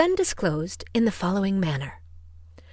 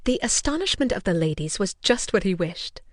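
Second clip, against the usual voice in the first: ordinary delivery, nothing marked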